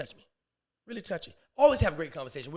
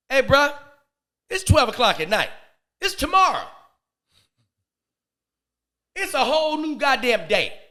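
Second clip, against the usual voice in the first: loud, high-pitched protest; mock indignant shout; 9 semitones higher, 11 dB louder than usual. Man protesting the neighbor's midnight ring, mock indignant shout. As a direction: shout each phrase like a complaint.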